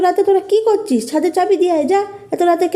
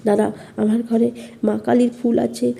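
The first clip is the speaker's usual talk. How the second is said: frightened hush; 9 semitones lower, 5 dB quieter than usual. Acted. Mother hurries, frightened.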